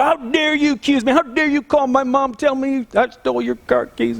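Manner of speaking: high, indignant mock outrage; retold angry customer; livid customer. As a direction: play the furious customer demanding answers. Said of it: "He's just livid".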